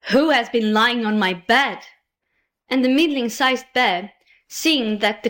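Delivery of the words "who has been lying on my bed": usual speaking voice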